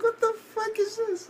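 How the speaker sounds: falsetto squeal of mock disbelief; incredulous reaction. Viewer reacting to the mom allowing a beating, incredulous. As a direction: squeal it way up high. Acts out reaction to mom's remark.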